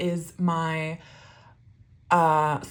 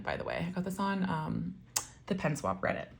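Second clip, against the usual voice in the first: normal talking voice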